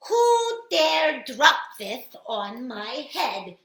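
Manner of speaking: furious, slow, loud demand; angry shout. Acted. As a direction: bellow it in outrage. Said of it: "shouted little Mole angrily".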